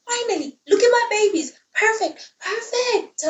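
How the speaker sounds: very high, gushing proud mom; hammed-up high doting mother; proud mother Gaia. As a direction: coo over perfect babies like a doting mother. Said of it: "And Gaia's like".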